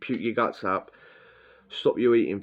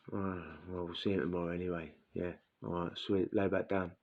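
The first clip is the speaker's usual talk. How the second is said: much softer, shrugging it off; a quiet, resigned aside, mimicking the mates; resigned mumble; 3 semitones lower, 10 dB quieter than usual. Mates resign themselves, lie down.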